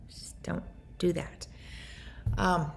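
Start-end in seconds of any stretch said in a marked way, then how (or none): 0.1-1.5 soft whispered plea. Real, not acted. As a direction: whisper it gently to the listener.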